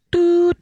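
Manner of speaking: high short beep; beep sound. Acted. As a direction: beep like a phone tone. Imitates beep tone.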